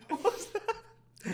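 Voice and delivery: high giggling question; laughing. Real, not acted. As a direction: ask it through laughter, drawn out. Reacting to odd toast.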